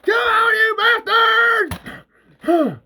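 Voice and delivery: high, loud battle cry; taunting shout. Acted. Bert luring creatures away from friends, taunting shout. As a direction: yell to lure the enemy on.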